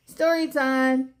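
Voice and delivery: calling out. Acted. Teacher calls the children to the rug, cheerfully. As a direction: call the class over, drawn out and bright. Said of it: "Miss Elena called".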